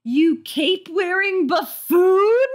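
high, slow, incredulous shriek; high drawn-out outrage; shrieking disbelief. Acted. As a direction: scream at the absurd buffoon. Carly outraged at scientist.

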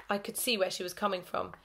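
ordinary delivery, nothing marked

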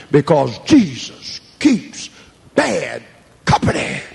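slow, trailing into a whisper; slow dramatic drop. Real, not acted. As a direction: let it land slowly, fading to breath. Slow punchline ending in whisper.

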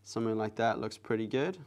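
normal talking voice

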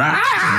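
sudden high ape yell; ape shout. Retold or imitated. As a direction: burst out with an ape cry. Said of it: "it's like going".

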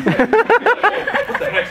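very high, slow, loud disbelief; high drawn-out stunned question; astonished. Retold or imitated. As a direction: stretch it out in shock.

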